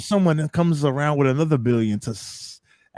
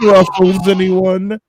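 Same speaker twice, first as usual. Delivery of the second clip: mock offering; 5 semitones higher, 6 dB louder, much slower than usual. Offering tea-bag "chocolates.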